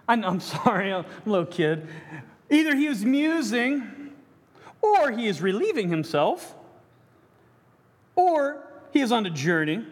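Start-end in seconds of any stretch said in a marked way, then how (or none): 2.5-9.8 higher, sarcastic taunting of the false gods; mocking Elijah. Elijah taunting Baal's prophets, sarcastic and higher. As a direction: taunt them with a sneering lift. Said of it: "Elijah mocked them, saying".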